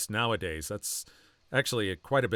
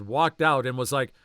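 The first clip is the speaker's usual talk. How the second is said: usual speaking voice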